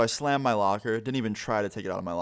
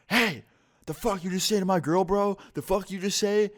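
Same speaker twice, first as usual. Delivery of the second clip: higher, louder tough-guy yell at me; aggressive boyfriend; 10 semitones higher, 3 dB louder than usual. Retold or imitated. Boyfriend shouting down the hallway at me, high and loud. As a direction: play the angry boyfriend calling out, pitched up.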